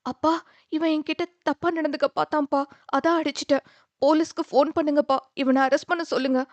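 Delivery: high, urgent, rushed plea; a daughter faking distress to cover up; panicked pleading. A narrator's reading. Daughter lies to father about the attack, panicked and high.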